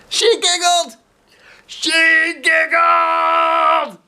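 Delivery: high, loud delighted exclaim; excited cry. Shown as a demonstration. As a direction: burst out with joy at the end.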